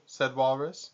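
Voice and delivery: usual speaking voice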